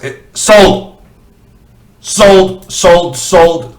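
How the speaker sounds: playful auctioneer closing the sale. Pretend.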